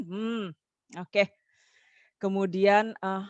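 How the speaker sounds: normal talking voice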